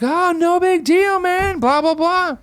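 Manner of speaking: very high, slow, breezy mock of Earl. Earl waving off the stranded guest: high, slow, breezy mock voice. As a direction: lilt it out like a carefree old guy.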